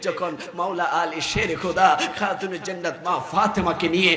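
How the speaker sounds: ordinary talk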